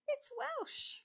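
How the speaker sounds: show-host reveal of Welsh; grand reveal. Acted. Host revealing the answer to children, excited.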